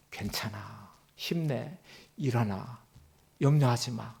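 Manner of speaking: God comforting the weary, soft and tender; gentle comfort. Retold or imitated. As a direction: hush it like consoling a hurt child. God's comforting words voiced.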